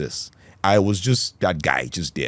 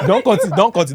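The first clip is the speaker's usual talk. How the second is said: laughing plea; 11 semitones higher, 5 dB louder than usual. Real, not acted.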